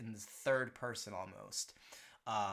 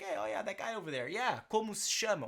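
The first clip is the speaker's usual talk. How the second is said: quick, high, casual chat about a guy; casual gossip; 7 semitones higher, 5 dB louder, faster than usual. Pretend. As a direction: point out someone across the bar, lightly.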